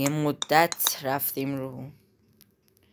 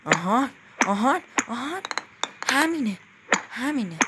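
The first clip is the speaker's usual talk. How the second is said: excited relief; 8 semitones higher than usual. Real, not acted. Finally clears the jump.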